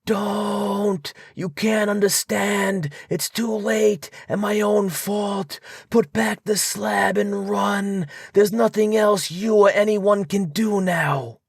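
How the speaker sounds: high, frantic, despairing plea; anguished high wail of hopelessness; scream of despair. A narrator's reading. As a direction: cry out that all is lost. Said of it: "changed to a scream of utter despair".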